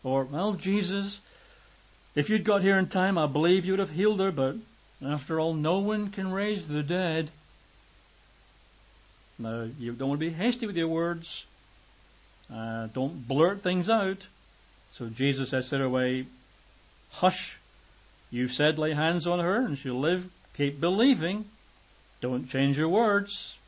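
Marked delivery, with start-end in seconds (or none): 0.2-7.3 resigned reproach. Pretend. Father reproaches Jesus for arriving late, accusing. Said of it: "Might even been angry". 17.1-23.6 firm reassurance. Acted. Jesus quiets Jairus firmly.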